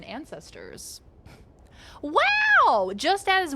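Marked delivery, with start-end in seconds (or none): 2.0-3.5 much higher, louder mock wow; mock amazement. Streamer reacting to the lice evolution fact, mock amazement. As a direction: feign being dazzled.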